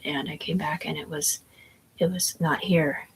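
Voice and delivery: ordinary talk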